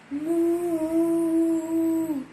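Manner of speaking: long, drawn-out moo; cow moo. Acted. Cow mooing to lull the dog, slow. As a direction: stretch the moo slowly like a cow. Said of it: "and cow went".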